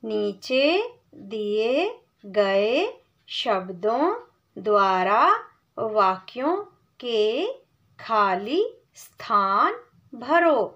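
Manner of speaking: word-by-word slow read of the instruction; teacher reads the task slowly; slow reading of the question. Shown as a demonstration. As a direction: read each word apart for the class. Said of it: "पहले प्रश्न को पढ़ेंगे".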